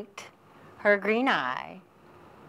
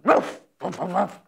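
a dog's woofing bark; 2 semitones lower than usual. Acted. Storyteller voicing the barking collie dog. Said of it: "raced barking".

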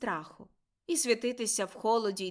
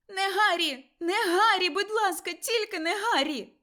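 high, loud terrified pleading scream; woman screaming; 10 semitones higher, 7 dB louder than usual. A narrator's reading. Mother screaming at the killer to spare her son, high terrified plea. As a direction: beg for the child's life at the top of your voice. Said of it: "кричав жіночий голос".

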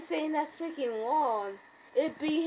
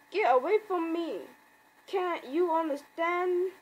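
higher-pitched acted protest; mock panicked plea; 6 semitones higher than usual. Comic fan voicing Rick fending off zombies, mock panicked plea. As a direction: plead to be left alone in mock panic.

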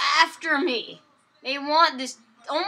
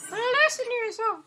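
creepy voice; 12 semitones higher, 5 dB louder than usual. Acted. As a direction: sneer like a creepy voice in your head. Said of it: "this creepy voice known as your heart".

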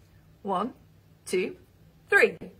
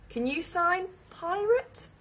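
normal talking voice

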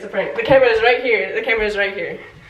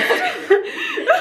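real high-pitched giggling; high laugh; 12 semitones higher than usual. Real, not acted. Wife laughs at confused partner, high giggle. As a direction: crack up at their confusion.

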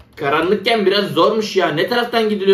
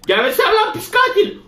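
panicked yell at monster; 11 semitones higher than usual. Real, not acted. Player fends off monster duck.

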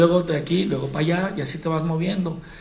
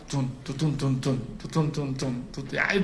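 imitating club beat; 4 semitones lower, 7 dB quieter than usual. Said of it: "canciones esas que les dicen de punchis punchis".

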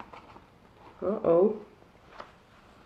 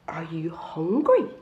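whisper; 4 semitones lower, slower than usual. Acted. Albert whispering to Pip.

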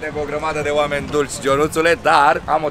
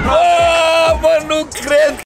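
high, loud cry of disbelief; amazed shout; 12 semitones higher, 5 dB louder, much slower than usual. Real, not acted. Amazed at the park. (strigăt uimit, mult mai sus)

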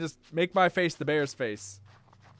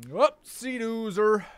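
silly voice; 7 semitones higher, 5 dB louder, much slower than usual. Pretend. Silly exclamation in game.

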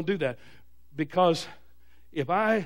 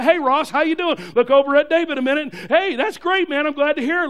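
higher, brighter hello, hammed up; cheery greeting; 5 semitones higher, 6 dB louder than usual. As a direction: greet him like an old friend. Pastor greeting a church member.

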